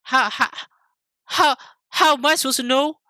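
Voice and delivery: high, loud incredulous protest, speeding up at the end; exasperated protest. Retold or imitated. Retelling his argument with mom, high exasperated protest. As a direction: plead in disbelief. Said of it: "and I'm like".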